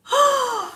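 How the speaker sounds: high, sharp gasp; real high-pitched gasp of shock; astonished gasp. Real, not acted. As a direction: suck in air in sudden surprise. Learns the odd word is real.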